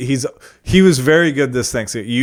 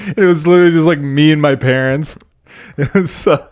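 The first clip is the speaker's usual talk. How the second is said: laughing; 4 semitones higher, 4 dB louder than usual. Real, not acted. Laughing at tiny family Thanksgiving.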